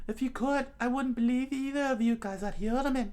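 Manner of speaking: very high falsetto retort; Nami character voice. Acted. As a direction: answer back in a high put-on girl's voice. Nami's lines in the reading.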